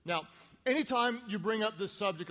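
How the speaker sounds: ordinary delivery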